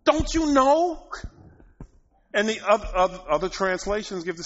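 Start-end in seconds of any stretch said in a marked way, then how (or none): 0.0-1.0 much higher and louder, slowing on don't you know; hammed-up apostle chiding his people; exasperated scolding. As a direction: scold them in mock disbelief. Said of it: "He's like".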